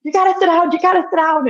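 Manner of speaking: high, loud, breathless call to sit down; urgent joy she can barely hold; excited urgency. Retold or imitated. Wife calling her husband to sit, high and urgent. Telling husband she's pregnant.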